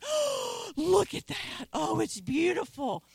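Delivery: oohing, starting high and easing down; gushing awe. Retold or imitated. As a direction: re-enact marvelling at beautiful scenery. Retold awe at the scenery.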